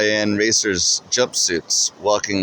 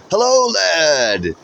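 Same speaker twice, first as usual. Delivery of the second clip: booming greeting; 8 semitones higher, much slower than usual. A narrator's reading. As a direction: boom it out from behind a mask. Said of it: "he nearly boomed from behind the mask".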